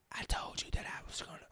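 hushed, voiceless TV-drama whisper; mocking whisper. Podcaster mimicking characters who whisper everything, mock whisper. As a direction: imitate an actor whispering their lines. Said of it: "everybody's whis".

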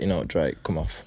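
low, soft mock brush-off; mock dismissive. Cohost telling Drake to come off, low soft mock. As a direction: murmur it like waving him away. Listener scolds Drake in retelling.

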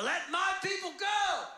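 commanding shout. Acted. Moses confronts Pharaoh in the palace, commanding. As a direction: proclaim it to a ruler, not yet all out. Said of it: "Say it with more gusto this time".

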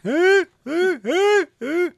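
high, loud honking laugh; imitated nerd laugh. Fan trading laughs with the nerd actor, loud honking imitation. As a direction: honk out repeated laughs like a movie nerd. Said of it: "a fan and him go back".

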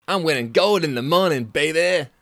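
high, loud Californian dude scoff. Acted. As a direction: squeak up the pitch like a cocky Californian. Billy boasting.

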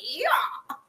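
high, loud, drawn-out moaning that fades to a breathy gasp; hammed-up ecstatic cries, ending in a whisper; mock pleasure moans. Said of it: "I might be like".

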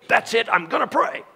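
high-pitched mock defiance; hammed-up defiant declaration, voice shooting up; rebellious outburst. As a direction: burst out like the stubborn flesh rebelling. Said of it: "rebellious nature of your flesh would go".

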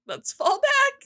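high, slow shouted order; urgent soldier call. Retold or imitated. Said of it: "they're like".

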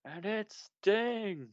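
drawn-out hype call of the name; slow, big wrestling commentator shout; announcer reveal. Pretend. Announcer-style reveal of Sting.

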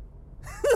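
a voiceless, very high squeak of a laugh; high squeal laugh. Real, not acted. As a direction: squeal with glee. Laugh before "I bet you didn't know.

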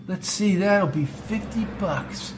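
slow, lazy stoner drawl; laid-back hippie. Acted. Stoner attendant voiced.

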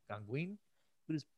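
ordinary talk

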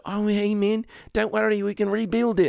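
breezy high mock cheer; high, carefree imitation of a laid-back guy; Taika Waititi. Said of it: "Taika Waititi's like".

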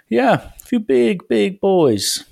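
high, drawn-out mock needling; slow, pointed retold taunt; insistent jibe. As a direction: stretch it out high to press the point. Coach repeats his jibe.

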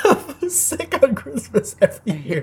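high-pitched, laughing grumble; laughter breaking into the words; laughing complaint. Real, not acted. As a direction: complain through your own laughter.

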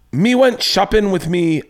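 hammy stage Irish lilt; Irish accent. Retold or imitated. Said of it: "I'm gonna do my Irish accent".

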